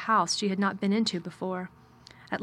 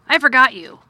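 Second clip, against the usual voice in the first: louder curt master asking; gruff man voice; 5 semitones higher, 13 dB louder than usual. Acted. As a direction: ask it with cool distance. Mr Craven speaks.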